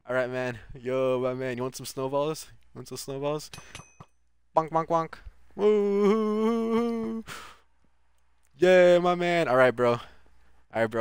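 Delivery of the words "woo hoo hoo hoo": high, slow woo-hoo-hoo hoot; mock hooting. Streamer teasing an opponent with snowballs, high slow hoot.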